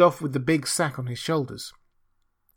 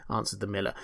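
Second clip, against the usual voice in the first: ordinary delivery, nothing marked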